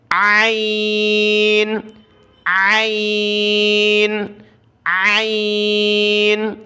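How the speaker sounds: very slow ain pushed from the throat; عین stretched from the throat; throaty ain. Shown as a demonstration. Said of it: "تو یہ عین ہے".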